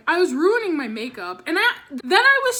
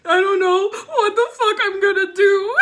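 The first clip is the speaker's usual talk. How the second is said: louder, laughing through mock tears; half-laughing despair; 5 semitones higher, 6 dB louder than usual. Retelling her panic on stage, laugh-crying and loud. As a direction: wail it like it's hopeless, half laughing. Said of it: "And I'm like".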